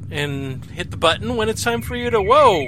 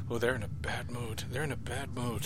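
much softer, under the breath; hushed aside; 8 semitones lower, 15 dB quieter than usual. Real, not acted. Muttering to the audience about the grumpy pair, hushed. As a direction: murmur it so they don't overhear.

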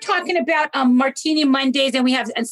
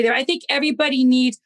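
normal talking voice

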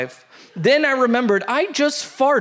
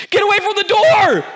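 put-on possessed-girl shriek, much higher and louder; Regan Exorcist scream; 12 semitones higher, 6 dB louder than usual. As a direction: scream it like a demon-possessed child. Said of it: "I screamed like Reagan from The Exorcist".

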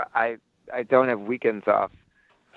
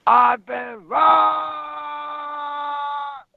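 much higher, long held scream of outrage; Angry Grandpa scream; 14 semitones higher, much slower than usual. Retold or imitated. Yelling an Angry Grandpa quote at the concert, drawn-out scream. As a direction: holler it like an old man who's been cheated. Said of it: "yelling Angry Grandpa quotes".

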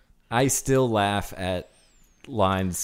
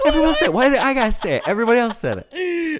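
high whining complaint, louder; Larry complaining about the veteran, high whine; whiny indignant Larry; 13 semitones higher, 3 dB louder than usual. Retold or imitated. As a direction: whine it like a wronged child. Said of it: "he goes".

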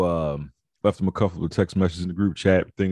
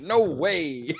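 mock firm refusal; 8 semitones higher than usual. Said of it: "Desmond said".

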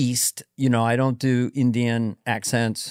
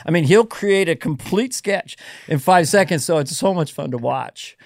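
ordinary talk, nothing marked

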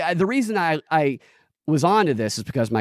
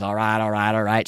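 Matthew McConaughey; 5 semitones lower than usual. Retold or imitated. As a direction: toss off a mild Texan catchphrase impression. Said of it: "tired of his".

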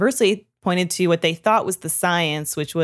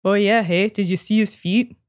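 mock Canadian accent; 3 semitones higher than usual. Reacting to the Canadian roasting story, mock Canadian accent. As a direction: put on a playful Canadian lilt. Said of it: "I can do that because I'm Canadian".

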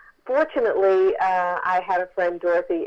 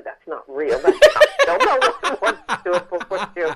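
laughing it off; 3 semitones higher, 5 dB louder than usual. Retold or imitated. Mother laughing off the book idea.

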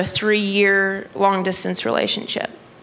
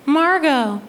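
high mom answering; put-on high mom voice; mom's voice; 7 semitones higher than usual. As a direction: imitate mom, pitched up. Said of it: "my mom said".

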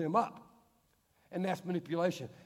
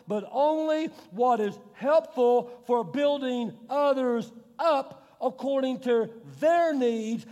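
proclaimed verse; 6 semitones higher, 5 dB louder, slower than usual. Real, not acted. Verse proclaimed at full force.

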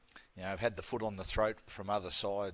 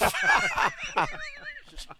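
big high laugh; 5 dB louder than usual. Real, not acted. Host tells of missing the upgrade, loud high laughter.

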